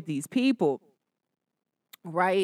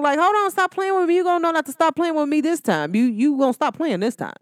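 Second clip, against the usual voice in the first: ordinary talk, nothing marked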